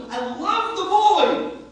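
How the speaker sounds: high, loud and slow; earnest insistence. Retold or imitated. Rabbi insisting to the Chacham he truly loves his grandson. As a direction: plead that you truly mean it. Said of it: "Chacham, I'm not joking, really".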